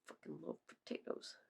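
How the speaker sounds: breathy whisper. Real, not acted. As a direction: whisper it fervently. Whispered after yell.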